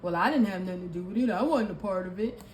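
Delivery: a fast, faux-innocent excuse; mock innocent denial. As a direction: imitate them playing dumb. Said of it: "they gonna act like they don't know".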